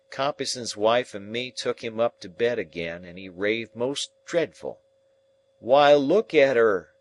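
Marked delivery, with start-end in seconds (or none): 5.6-6.9 loud, slow, horrified cry; terrified cry. Acted. Dying man crying out in terror to his keepers. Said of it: "he cries out".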